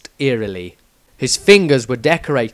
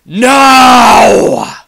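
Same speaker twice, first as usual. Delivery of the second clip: much higher, very loud booming shout; thunderous shout; 11 semitones higher, 14 dB louder than usual. Acted. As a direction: crack it like lightning. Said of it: "voice like the crackling of lightning".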